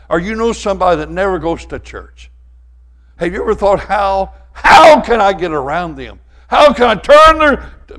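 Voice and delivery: loud, high, rising fire-and-brimstone preaching; preaching at full force. Acted. Preacher warning his church about living in sin, full-force preaching. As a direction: build it up and hammer the congregation. Preaching on Jesus seeing people.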